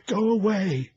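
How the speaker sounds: put-on high, loud shriek of a frightened child; child's terrified scream. A narrator's reading. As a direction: scream it like a small child begging. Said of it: "it screamed".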